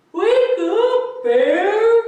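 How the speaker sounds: high, loud wake-up call; sleepy mole calling his friend awake, high and loud; Mole waking Bear. A narrator's reading. Mole wakes his friend Bear, high and loud call. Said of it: "mumbled Mole".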